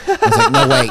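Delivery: laughing, high and loud. Retold or imitated. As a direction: crack up while pleading. Retold joking reply, laughing.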